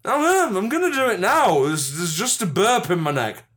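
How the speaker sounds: put-on high, indignant drunk; drunk Gandhi. Gandhi promising to show his voice, high mock-drunk protest. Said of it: "Drunk Gandhi, let's do this".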